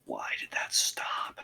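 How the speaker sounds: whispered, slow question. Real, not acted. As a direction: whisper it under breath. Whispered aside.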